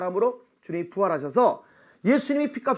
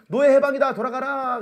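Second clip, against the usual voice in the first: proclamation; 5 semitones higher, 4 dB louder than usual. Acted. Proclaiming release of slaves.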